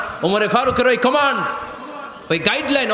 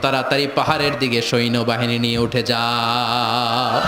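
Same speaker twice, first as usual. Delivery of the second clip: low, slow sustained call; drawn-out command; 8 semitones lower, slower than usual. Acted. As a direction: call the order out in long, drawn syllables. Calls out commander's order to army.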